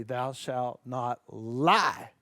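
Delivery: slow, grave biblical proclamation; solemn commandment voice. Shown as a demonstration. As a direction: intone it like scripture.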